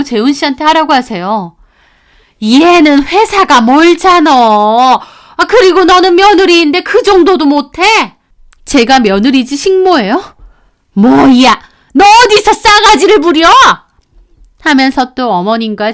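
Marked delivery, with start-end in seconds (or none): 2.4-7.8 scornful mother-in-law. Acted. Mother-in-law mocking daughter-in-law's job, scornful. 10.9-13.5 much higher, louder snap; angry outburst. Acted. Mother-in-law yelling at rude daughter-in-law.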